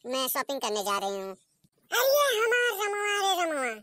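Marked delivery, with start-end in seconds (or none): 1.9-3.7 hammed-up wailing call for her son; mock wail. Mother-in-law calling for her son Ramua, hammed-up wail. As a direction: cry out the son's name, higher, slowly.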